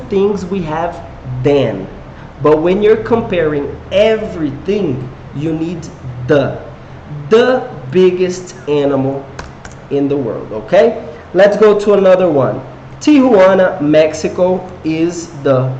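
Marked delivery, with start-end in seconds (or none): none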